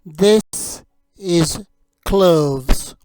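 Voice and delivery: very slow, deliberate "This is cloves."; slow teaching model. Shown as a demonstration. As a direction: stretch out "This is cloves." syllable by syllable. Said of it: "You say it".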